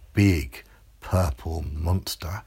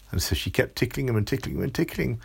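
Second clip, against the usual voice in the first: ordinary delivery, nothing marked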